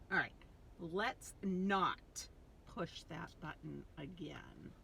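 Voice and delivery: usual speaking voice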